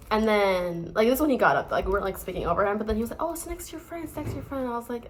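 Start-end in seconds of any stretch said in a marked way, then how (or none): 3.2-4.6 lightly voiced passenger, high and soft; imitated seatmate. French passenger pointing out her friend, softly imitated in a high voice. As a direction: pitch it up softly as another person.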